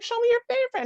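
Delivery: put-on high little girl voice; squeaky child asking eagerly; a small child demanding her favorite book; quoted child.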